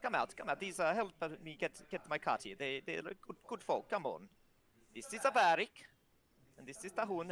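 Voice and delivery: a keen lad showing off his new friends; young cheerful voice. Acted. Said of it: "he's like".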